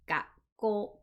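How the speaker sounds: "gakko" with a silent stop before "ko"; distinct pause mid-word. Shown as a demonstration. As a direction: hold the double k in "gakko" as a gap. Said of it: "The pause is very, very important".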